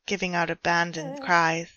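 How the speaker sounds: usual speaking voice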